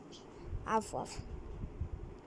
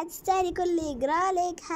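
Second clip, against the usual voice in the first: mocking taunt; 6 semitones higher than usual. Kidnapper taunts captive girl.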